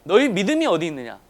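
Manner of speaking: preacher quoting a firm reproach; retold rebuke. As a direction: repeat the rebuke loudly, slightly contained. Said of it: "호통으로 이어져요".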